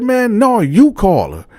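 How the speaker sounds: retold defiant shout; high defiant retort. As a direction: throw the demand back at her. Throws it back at her.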